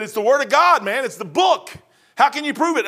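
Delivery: getting on track and preaching; fired up. Retold or imitated. Preaching back with growing fire.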